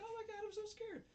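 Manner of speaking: very high mock-frightened squeal; mock scared. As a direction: squeak it out like a fake-scared girl. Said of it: "he's like".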